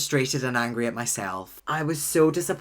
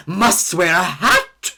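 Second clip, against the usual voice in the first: dramatic insistence; 4 semitones higher, 9 dB louder, much slower than usual. Retold or imitated. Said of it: "I was like".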